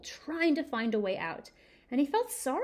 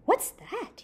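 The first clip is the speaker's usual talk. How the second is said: higher, startled question; startled cry; 10 semitones higher, 3 dB louder than usual. Acted. Simon calls out at a strange sound, startled. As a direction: call out, surprised. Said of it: "he cried".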